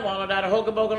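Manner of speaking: ordinary delivery, nothing marked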